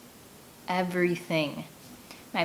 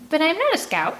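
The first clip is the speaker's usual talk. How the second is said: put-on much higher, louder girlish protest; whiny protest; 9 semitones higher, 5 dB louder than usual. A narrator's reading. Girl objecting to the group, petulant and high.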